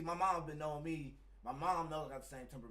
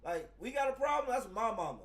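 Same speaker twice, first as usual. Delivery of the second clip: much higher, louder challenge; retold confrontation; 9 semitones higher, 9 dB louder than usual. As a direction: square up to the guy staring at your mom.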